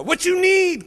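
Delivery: put-on high, loud Indian accent, curt; rude attendant. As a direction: drop in the attendant's snappy line.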